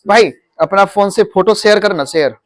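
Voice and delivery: शेयर said as "ser" with plain S; wrong S sound. Shown as a demonstration. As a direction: mimic the common slip, S for SH in शेयर. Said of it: "कहते हैं". (शेयर को "सेयर" की तरह बोलें)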